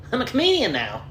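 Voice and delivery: high, mocking self-important voice; mock a show-off beginner; smug new comedian. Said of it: "Post a picture of me holding a microphone".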